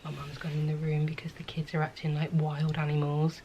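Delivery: hushed aside to camera; whispering. Real, not acted. Aside while kids run wild.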